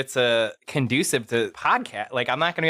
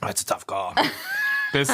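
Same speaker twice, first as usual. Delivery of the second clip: breathy, strained by sour candy; words forced out around sour candy; eating sour candy; 6 semitones higher than usual. Real, not acted. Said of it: "It's so sour".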